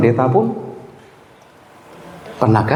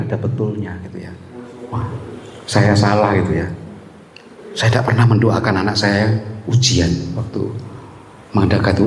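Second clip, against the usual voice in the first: ordinary talk, nothing marked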